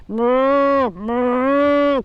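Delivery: drawn-out lowing moo; cow moo. Acted. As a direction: moo like cattle. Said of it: "called the cattle".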